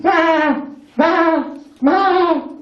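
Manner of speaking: mock bleating ma ma; sheep bleat. Sheep noise prompt.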